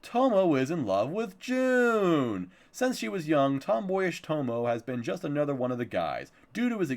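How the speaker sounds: dramatic series-blurb delivery; synopsis read-out. Acted. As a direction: play up the plot summary like a promo.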